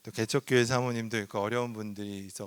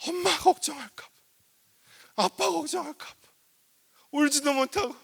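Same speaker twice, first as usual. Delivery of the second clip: loud, high impassioned sermon; full-force preaching; 13 semitones higher, 8 dB louder than usual. Acted. Pastor urging the congregation, loud and impassioned. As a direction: preach it out with fervor.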